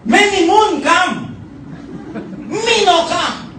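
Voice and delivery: hammed-up outraged husband, slow; angry husband, broken English. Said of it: "and the husband said".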